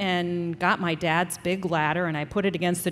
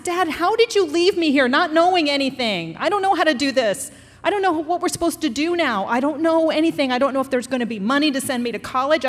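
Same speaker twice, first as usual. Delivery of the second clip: higher, louder pleading anger; angry outburst at Dad; 7 semitones higher, 4 dB louder than usual. Retold or imitated. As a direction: shout your frustration at your absent father. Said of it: "and I got mad".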